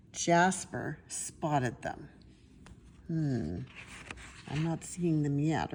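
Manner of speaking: ordinary delivery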